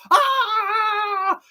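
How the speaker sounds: high mock scream with a laugh; imitated scream. Imitating Rev's blood-curdling scream when staked, half laughing. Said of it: "you hear him fucking scream".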